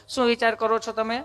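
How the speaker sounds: lightly voiced nurse, softened; nurse asking questions. Nurse questioning patient at vaccine centre, lightly imitated. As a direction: play a polite clinic nurse. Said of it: "nurse બેને પૂછવા".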